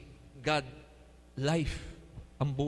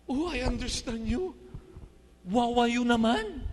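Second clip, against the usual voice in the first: mock pity; 10 semitones higher than usual. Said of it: "sinabi ni Lord sa kanya".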